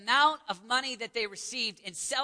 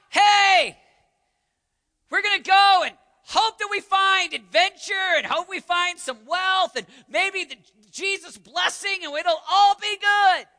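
loud, high, hearty shout; hollered mock eagerness; 9 semitones higher, 12 dB louder, slower than usual. Preacher voicing adventurers hoping for wealth and blessing, shouting. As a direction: holler it like a rallying cry.

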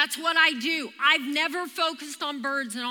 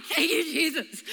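comic relief, as if just escaped; mock breathless relief. Runner who just lost the chasing bird, mock breathless relief. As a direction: act out a winded thank-you.

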